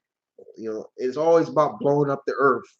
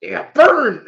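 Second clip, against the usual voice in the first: loud, higher mock taunt; mock gleeful yell; 6 semitones higher, 9 dB louder than usual. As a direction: shout it like a playful taunt. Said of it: "I'd be like".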